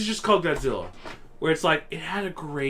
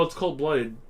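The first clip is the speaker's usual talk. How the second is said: ordinary delivery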